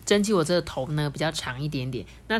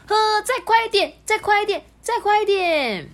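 put-on much higher, louder kids' cheering; excited shout; 6 dB louder than usual. Children in the carriage cheering the train to go faster, much higher and louder. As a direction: egg the train on with glee. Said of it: "兴奋的大叫说".

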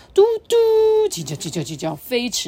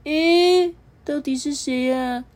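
just-woken, groggy voice; drowsy yellow button; sleepy; 8 semitones higher, 4 dB louder, slower than usual. Acted. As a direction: mumble as if rubbing your eyes. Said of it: "揉着眼睛说".

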